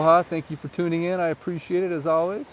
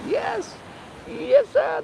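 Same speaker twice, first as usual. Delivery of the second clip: squeaky yes sir; much slower than usual. Acted. Answering a viewer that the gazebo's open, squeaky high. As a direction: chirp it like a cartoon.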